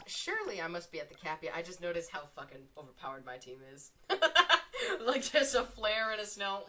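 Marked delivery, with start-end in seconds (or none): 4.1-5.0 short, high, loud laugh; bursting laugh. Real, not acted. Gamer amused at the teams, high loud laugh.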